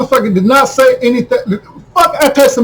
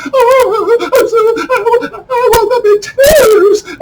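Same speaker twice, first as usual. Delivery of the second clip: very high, hammed-up weepy wail with a laugh; mock her fake tears, sobbing high; mock crying Jamie Lee Curtis; 12 semitones higher, slower than usual. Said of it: "get off in her feelings".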